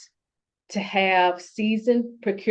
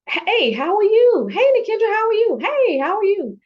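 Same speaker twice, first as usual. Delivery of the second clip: much higher and louder, singsong hello; mock caller greeting; 7 dB louder than usual. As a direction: play the cheery caller greeting her again and again. Retold cheery phone greeting.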